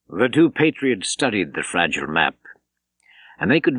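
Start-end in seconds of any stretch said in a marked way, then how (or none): none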